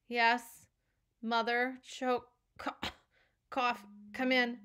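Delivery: a lightly voiced boy hamming up being hurt; mock choking and coughing through the words; spoiled son faking injury to his mother; fake sick groaning. A narrator's reading. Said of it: "Todd's groans got a lot louder".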